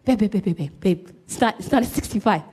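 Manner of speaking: low, coaxing wife; imitated wifely patience, held in; wifey tone. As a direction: gently nag your husband with pet names. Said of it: "I still had my wifey tone".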